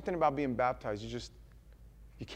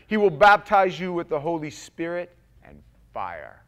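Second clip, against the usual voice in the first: loud, fervent verse reading; preaching scripture; 3 semitones higher, 12 dB louder than usual. Acted. Preacher reading verse eleven aloud, fervent. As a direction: proclaim it to the congregation.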